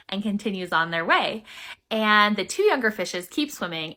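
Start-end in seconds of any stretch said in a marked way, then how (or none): none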